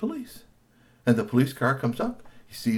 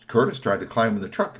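plain reading voice

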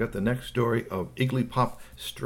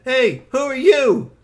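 put-on loud, high, slow child challenge; nosy little kid; 14 semitones higher, 10 dB louder, slower than usual. A narrator's reading. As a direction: call it out drawn-out like a small kid.

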